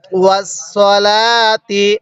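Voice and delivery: ওয়াসসালাতি drawn out slowly and articulated clearly; careful recitation. Shown as a demonstration. Teacher teaching the word, slow careful recitation. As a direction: model ওয়াসসালাতি slowly for the class. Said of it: "কিভাবে পড়তে হবে?".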